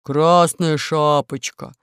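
slow, loud wolf growl. Acted. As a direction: growl her name. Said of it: "зарычал волк".

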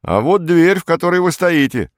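high, loud pointing-out; stout shop assistant. A narrator's reading. Shop assistant shows Perets the door, high and loud. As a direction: call it across the counter, high-pitched. Salesman loudly directs Perets.

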